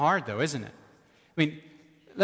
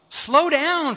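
slow down called out much higher and louder; emphatic plea; 11 semitones higher, 7 dB louder than usual. Real, not acted. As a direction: pastor pleading with readers to slow down, raised voice. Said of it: "urgent exhortation".

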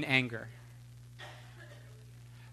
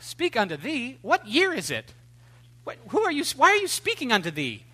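higher, baffled mock disbelief; incredulous mockery; 7 semitones higher than usual. Preacher mocking the pompous prophet, higher incredulous tone. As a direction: scoff at the archaic talk. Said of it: "I'm like".

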